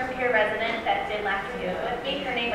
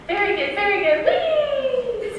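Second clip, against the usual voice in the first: higher, louder cheer ending in a squealed wee; Henriette's cheer; 11 semitones higher, 7 dB louder, slower than usual. Retold or imitated. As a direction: cheer like a jolly hostess, wee flying high. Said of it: "she would say".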